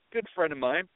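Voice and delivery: usual speaking voice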